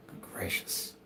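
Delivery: exasperated whisper under breath. Real, not acted. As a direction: mutter it voicelessly.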